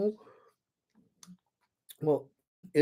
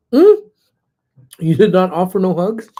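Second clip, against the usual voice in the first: mock disbelief; 6 semitones higher, 10 dB louder than usual. Real, not acted. Reacting to chat denying hug.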